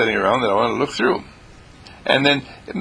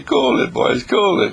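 mild scolding; 12 semitones higher than usual. Retold or imitated. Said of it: "I have to say".

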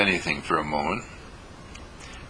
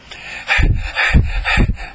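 loud unvoiced blown breath; heavy panting sigh; 6 dB louder than usual. Shown as a demonstration. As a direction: puff hard like after a sprint. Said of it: "all out of breath".

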